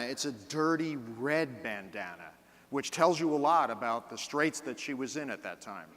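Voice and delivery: normal talking voice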